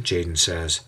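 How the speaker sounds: ordinary delivery, nothing marked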